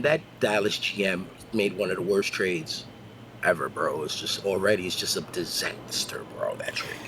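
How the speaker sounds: dropping to a soft, whispered groan; quiet disgust. Real, not acted. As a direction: sink into a hushed disbelief. Disgust over a bad trade.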